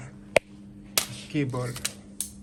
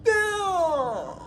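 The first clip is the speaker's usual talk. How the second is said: amazed cry. Real, not acted. Amazed at his new Mac.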